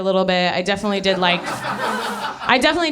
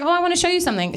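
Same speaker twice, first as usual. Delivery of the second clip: high showy; 9 semitones higher, much faster than usual. Retold or imitated. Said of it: "he was like".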